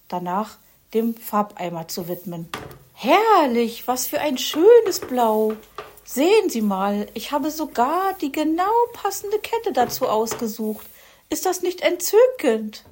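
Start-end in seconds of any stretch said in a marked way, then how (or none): 2.9-12.9 high, loud gushing magpie lady; fussy Frau Elster. A narrator's reading. Frau Elster raves about the blue to Herr Fuchs, high gushing. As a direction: gush over the paint colour.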